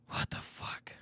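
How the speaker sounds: whispered, breathless what the fuck; stunned whisper. Real, not acted. Disbelief, then whispered repeat.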